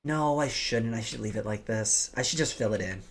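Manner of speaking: ordinary talk, nothing marked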